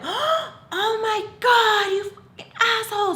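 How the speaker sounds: high, loud, slowed outraged cry with a laugh in it; imitating a drunk friend's shrieking rage; mock furious swearing. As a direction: ham up a friend's furious curse. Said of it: "she just starts swearing at him".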